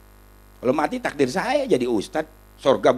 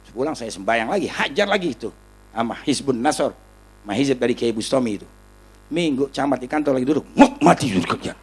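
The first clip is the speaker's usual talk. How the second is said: ordinary talk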